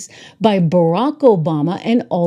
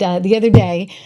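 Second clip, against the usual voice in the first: usual speaking voice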